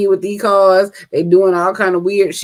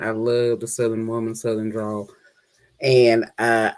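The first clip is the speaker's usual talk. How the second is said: ordinary talk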